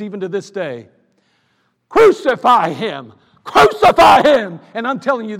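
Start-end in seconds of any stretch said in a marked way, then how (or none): 1.9-4.6 slow, roaring mob cry, much louder; crowd shout. Retold or imitated. Preacher voicing the crowd at the trial, roaring mob shout. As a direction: bellow it like an angry mob.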